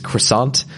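"croissant" as American "cruh-SAHNT"; "croissant" the plain American way, no French nasal; American pronunciation. Shown as a demonstration. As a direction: say "croissant" with a sounded final T. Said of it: "Americans have a good solution".

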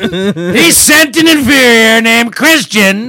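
slow, loud, drawn-out tragic complaint; melodramatic lament. Acted. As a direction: drag it out like a scorned villainess.

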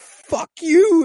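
high yelled curse; hammed-up yelled curse; mock high shout. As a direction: yell it up high in mock fury. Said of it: "He's like".

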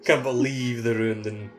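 ordinary talk

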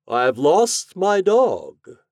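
high, loud, very slow wizard voice; drawn-out theatrical wizard's declaration; announcing wizard. A narrator's reading. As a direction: announce it grandly, stretching every word. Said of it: "he announced".

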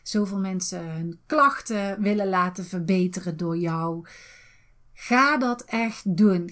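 ordinary talk

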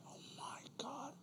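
barely audible, voiceless whisper; whispered mock dismay. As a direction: whisper it in hammed-up despair. Whispered echo of acted complaint.

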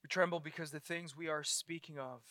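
hushed prayer. Real, not acted. Preacher leads the congregation in prayer, soft and reverent. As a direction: pray gently before God.

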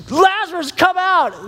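high ringing summons without extra volume; calling out. Acted. Preacher re-enacting Jesus at the tomb, calling out. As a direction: pitch it up and call him out. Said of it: "Jesus called out in a loud voice".

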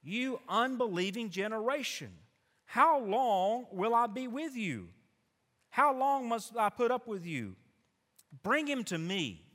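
Jesus rebuking the unbelieving crowd, loud and exasperated. Acted. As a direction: lament it over the crowd, loud and high. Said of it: "He replied to them".

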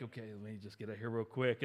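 quiet nervous aside at the bank counter; hushed stalling. Retold or imitated. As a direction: pretend you didn't hear, keep it under your breath. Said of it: "and I was like".

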